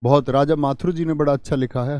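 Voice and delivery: normal talking voice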